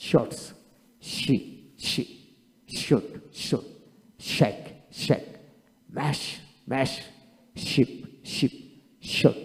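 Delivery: softly modelling each word twice; soft teacher drill of word pairs; quiet word drill. Shown as a demonstration.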